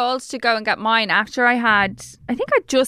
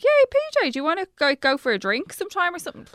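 high, chirpy mock posh Dublin invite; mock southside friend; 8 semitones higher than usual. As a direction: squeal the invite like a keen southside pal. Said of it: "I actually might even be like".

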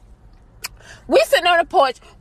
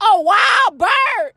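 slow shouted emphasis; 9 semitones higher, much slower than usual. Real, not acted. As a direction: stretch it out in disbelief. Comic emphasis.

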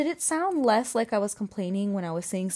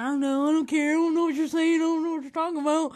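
high, fast southern-accented mimicry; exaggerated southern voice, running words together; mock southern accent; 7 semitones higher, 4 dB louder, faster than usual. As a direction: tease with a rushed, high southern imitation. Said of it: "I don't sound like that".

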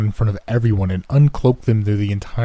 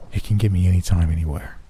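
ordinary delivery

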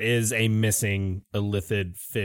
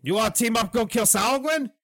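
lizard folk voice; 10 semitones higher than usual. Acted.

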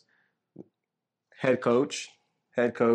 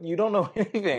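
laughing; 7 semitones higher than usual. Real, not acted. Mocking friend's sports claim.

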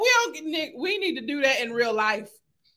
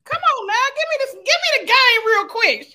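playful urging; 9 semitones higher, 6 dB louder than usual. Real, not acted. Host pushing guest playfully.

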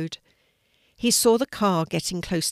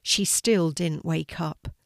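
usual speaking voice

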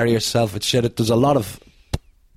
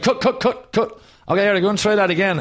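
director calling cut; 9 semitones higher than usual. Retold or imitated. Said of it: "Taddy said".

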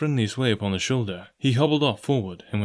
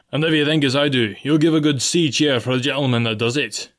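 higher, projected speech to all hands; captain's raised, commanding announcement; ship's captain, addressing crew; 3 semitones higher, 4 dB louder than usual. Acted. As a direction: address the men formally from the deck.

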